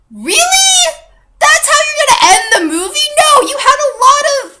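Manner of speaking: high, loud disbelieving cry with a laugh; incredulous shout. Real, not acted. Outraged rant about movie ending.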